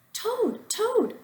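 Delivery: high calling shout; shouting Frog. A narrator's reading. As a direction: call him out of the house. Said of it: "shouted Frog".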